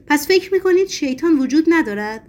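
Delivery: high, loud, harsh challenge; angry shout. A narrator's reading. The madman turns on his companions, shouting angrily. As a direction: shout it furiously as a demand. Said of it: "با عصبانیت و خشونت فریاد کشید".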